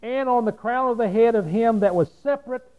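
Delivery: loud preacher's reading voice; booming pulpit reading; forceful scripture reading. Real, not acted. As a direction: declaim the verse loudly. Reads scripture at full force.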